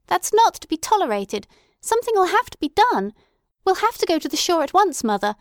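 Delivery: high, loud, indignant daughter; indignant protest. A narrator's reading. Daughter insisting her mother act, loud indignant protest. Luella protests the cousin's visit.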